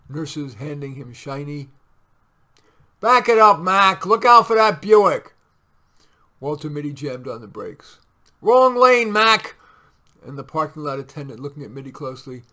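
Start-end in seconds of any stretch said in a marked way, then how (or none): 3.0-5.3 high, very loud bellow; urgent full-voiced shout; yelled warning. Parking attendant yelling at driver. 8.4-9.6 high, very loud bellow; urgent full-voiced shout; yelled warning. Parking attendant yelling at driver.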